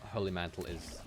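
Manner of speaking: ordinary delivery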